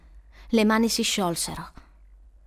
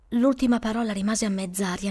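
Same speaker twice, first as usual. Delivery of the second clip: ordinary delivery, nothing marked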